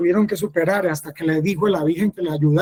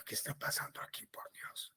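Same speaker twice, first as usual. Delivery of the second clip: fast, hushed whisper of alarm; an urgent private whisper to God; whispered bewilderment; 3 semitones lower, 25 dB quieter than usual. Retold or imitated. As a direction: whisper it quickly under your breath. Recalling his own bewildered thought.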